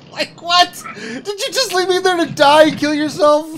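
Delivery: high, laughing disbelief; mock disbelief. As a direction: squeal your shock at being abandoned.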